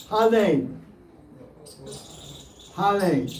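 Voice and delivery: "Hale" with an L where the R should be, ha-lay; Chinese pronunciation. Retold or imitated. Teacher showing how Chinese devotees say "Hale" for Hare. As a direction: say "Hale" like a Chinese speaker without R. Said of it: "They say Hale".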